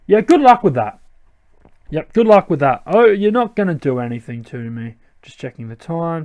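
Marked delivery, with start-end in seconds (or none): 0.1-1.0 higher, dismissive taunt; scoffing. Acted. Player answering Team Plasma's threat, scoffing and higher. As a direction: toss it back as a scoff.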